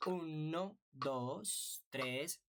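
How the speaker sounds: "Uno, dos, tres" stretched on even beats; measured musical count-in; slow count-in. Shown as a demonstration. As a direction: count out a steady pulse, drawing out each number. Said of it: "Vamos a contar".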